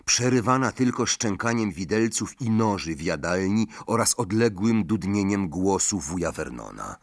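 ordinary delivery, nothing marked

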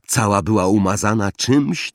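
plain reading voice

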